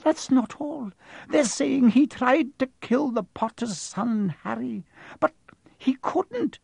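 high, trembling, distressed telling; distraught, high-pitched and quavering. A narrator's reading. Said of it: "voice trembled as she went on".

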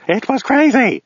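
high-pitched frantic babble; babbling madman. Acted. Madman in the tank raving to his finders, frantic high babble. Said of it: "he babbled".

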